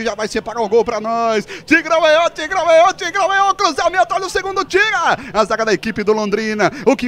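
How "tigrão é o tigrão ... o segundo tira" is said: high, urgent chanting call; excited play-by-play. Real, not acted. Commentator calling a cross for a second goal, high and excited. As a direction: chant the team on as the attack unfolds.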